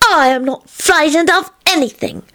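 put-on high, emphatic little-kid denial; high-pitched stubborn protest; indignant child. A narrator's reading. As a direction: insist proudly like a small child. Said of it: "hollered indignantly".

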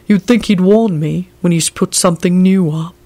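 put-on servant voice, aggrieved; accusing. A narrator's reading. Servant complaining about his master's new display, accusingly. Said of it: "he said accusingly".

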